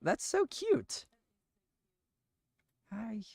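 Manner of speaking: gasp, then melt over the sleeping pet; cooing at pet. Real, not acted. Cooing at Gizmo the pet who lies down, high baby talk.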